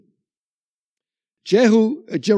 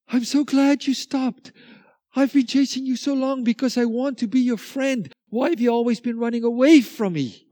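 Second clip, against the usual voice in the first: higher, gentle voice of the chasing dog; dog speaking kindly; 6 semitones higher than usual. Retold or imitated. Hound of heaven telling the hare it wants friendship. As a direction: speak as the friend who finally caught up. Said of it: "And the dog says".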